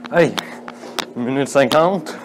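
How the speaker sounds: winded, pushing through laps; out of breath. Real, not acted. Running stairs, counting laps, straining.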